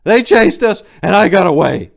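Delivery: loud, high desperate cry; anguished shout. Acted. Silenus crying out to Tor, loud desperate shout. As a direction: yell it out in anguish.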